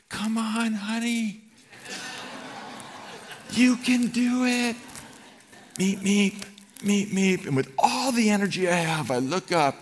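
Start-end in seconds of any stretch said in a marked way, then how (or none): 0.1-4.7 much higher, faraway cheering wife; wife calling from afar. Retold or imitated. Pregnant wife cheering her husband up the hill, high distant call. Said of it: "a faint voice from the top going". 5.8-7.5 higher, light imitation of a little car horn; car horn beeps. Husband imitating the horn heard from the hilltop, mock beeping. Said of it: "a horn".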